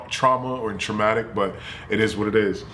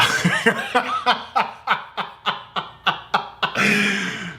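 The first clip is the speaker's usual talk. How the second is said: helpless drawn-out giggling fit; real long laugh; 9 semitones higher than usual. Real, not acted. Teller breaking up at his own family story, real laughter. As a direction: crack up and keep on laughing.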